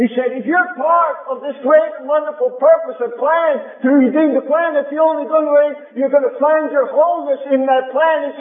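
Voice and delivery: high, fervent preacher's climb; preaching at full force. Acted. Preacher to congregation quoting Jesus, rising fervent preaching.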